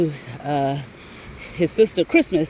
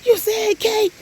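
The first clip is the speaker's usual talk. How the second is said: mock boyfriend; slower than usual. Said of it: "And he was like".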